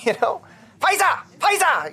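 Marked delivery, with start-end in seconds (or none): 0.8-1.2 barked Pfizer, much higher, mock German accent; mock German shout. Comic barking Pfizer in a mock German accent. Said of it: "sounds like a German guy". 1.4-1.9 barked mock German accent, much higher; mock German shout. Comic shouting it in a mock German accent. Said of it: "it almost sounds like a German guy".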